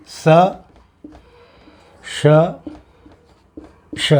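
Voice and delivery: स, श, श each held apart with pauses; स, श, श said as a slow teaching set; three sibilants. Shown as a demonstration. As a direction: contrast स, श, श one by one. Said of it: "ये तीन ध्वनियाँ हैं".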